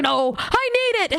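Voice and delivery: high, hammed-up pleading; mock desperation. Nervous performer grabbing a friend's drink, mock desperation. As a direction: insist like a comically desperate person.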